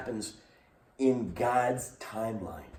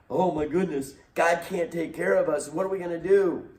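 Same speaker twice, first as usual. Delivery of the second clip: mock panic; 7 semitones higher, 4 dB louder than usual. Voicing panicking Israelites.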